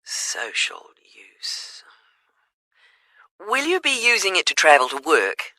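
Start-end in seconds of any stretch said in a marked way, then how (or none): 0.0-1.8 muttered under the breath while typing; whispered note to self. Real, not acted. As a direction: whisper it to yourself as you write it down. Agent notes answer while typing.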